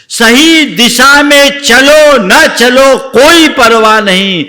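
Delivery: slow, loud, high exhortation; preaching at full force. Acted. Preacher exhorting the listeners, in a slow loud preaching voice. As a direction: drive the lesson home.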